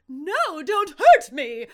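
loud, slow, high frightened cry; dramatic scared plea. Retold or imitated. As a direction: beg in a hammed-up trance terror. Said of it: "sounds very dramatic, but also very scared".

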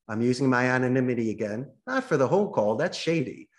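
ordinary delivery

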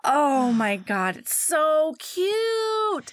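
long drawn-out gush, rising high on cute; gushing delight. Real, not acted. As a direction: swoon over it, stretching every word. Gushing over a cute scene.

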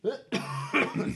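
dry unvoiced cough; coughing fit. Real, not acted. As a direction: cough hard, clearing the chest. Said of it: "Still coughing".

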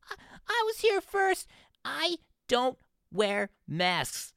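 whiny ranting voice pitched far up; mocking freak-out customer. Mocking a YouTube freak-out customer in a store, high whiny rant. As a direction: imitate a convenience store customer melting down, high and strained. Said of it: "It's like".

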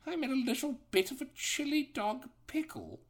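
very high, halting British gentleman; Tails. Acted. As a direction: draw it out as a hammed-up British gent. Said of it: "He sounds like a distinguished old British gentleman".

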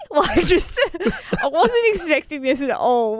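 high, laughing disbelief. Real, not acted. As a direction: laugh through the words. Laughing while telling.

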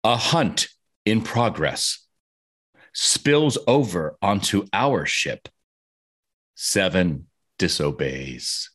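slow, measured poem reading; haiku recital. Shown as a demonstration. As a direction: read the haiku slowly and evenly. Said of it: "my haiku for Prey".

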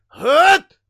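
high, loud barked grunts like Link; mocking the Foot Clan leader's grunting shouts; aggravated grunting shouts. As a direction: grunt out each word as an angry shout. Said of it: "Link only speaks in grunts".

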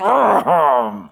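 loud imitated car engine rev; engine roar. Preacher showing off a souped-up car, roaring engine noise. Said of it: "makes a big roaring sound".

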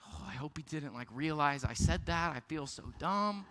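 soft, low mumble to himself; quietly cringe at your own words; muttered self-conscious aside; embarrassed inner thought. Retold or imitated.

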